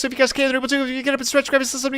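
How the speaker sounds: rapid-fire rush. Real, not acted. Streamer sending viewers off before ads start, rapid-fire rush. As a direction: race through it before time runs out.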